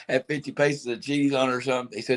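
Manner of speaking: normal talking voice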